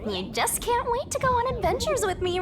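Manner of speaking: ordinary talk, nothing marked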